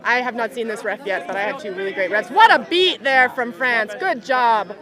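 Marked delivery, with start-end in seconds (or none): 2.3-4.7 loud, lively commentary; excited praise. Real, not acted. Commentator praising a kind tackle from Italy. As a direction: cheer the good sportsmanship on.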